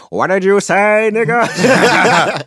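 high, slow, loud comeback; mock outraged shout. Reacting to the nurse's question, mock outraged yell. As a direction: stretch it out, breaking into a laugh.

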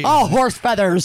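slow, loud grandpa exclamation; old grandpa slang. Retold or imitated. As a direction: sound like a crusty old grandpa. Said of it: "major slang of my grandpa".

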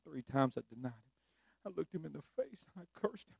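soft, low, half-whispered self-reproach; whispered guilty inner monologue; guilt-ridden Peter. Acted. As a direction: Peter berating himself under his breath.

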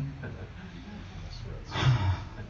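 long, weary sigh out; husband's put-upon sigh; exasperated sigh. Retold or imitated. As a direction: sigh like you've been interrupted. Reacting to wife's request.

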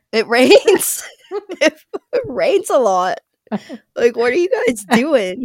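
laughing while talking. Retold or imitated. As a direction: laugh through the disbelieving words. Retold disbelief, laughing.

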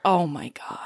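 low, whispered reaction; hushed disbelief. Real, not acted. Cohost reacting to the breakup, hushed whisper. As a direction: breathe it out under your voice, stunned.

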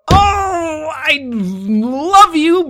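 high, squealing cry of relief; overjoyed cry. Retold or imitated. To the clerk who returned the laptop, a high relieved cry. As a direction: wail it out, half laughing with relief.

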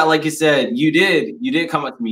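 ordinary talk

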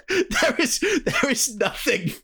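high, slow, laughing through words; laughing while talking. Real, not acted. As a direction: struggle to talk through laughter. Laughing at absurd movie scene.

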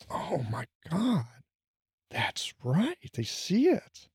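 soft, breathy whisper of sudden realization; quiet, wonder-struck half whisper; awed hush. Retold or imitated. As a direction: hush it like a patient who finally sees it. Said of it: "their eyes get really wide and they get really quiet".

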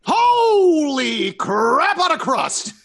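high, drawn-out amazed shout; amazed exclamation. Real, not acted. Player amazed by the damage roll, long high shout. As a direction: stretch it out high in astonishment.

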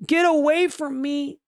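high-pitched drawn-out "get away"; high mock-alarmed warning; acted warning. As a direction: shoo them off in a high, slow voice. Acts out gunman warding off others.

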